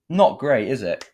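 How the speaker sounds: scoffing review verdict; blunt verdict. Real, not acted. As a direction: lay it on for the joke. Verdict on the episode.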